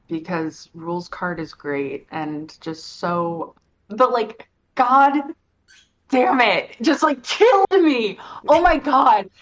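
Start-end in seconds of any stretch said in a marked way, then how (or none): 4.8-9.3 much louder, higher exclamations; overwhelmed delight. Real, not acted. Speaker raving to a friend about a favourite character, loud excited. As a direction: burst out like it just killed you. Gushing about Rules card.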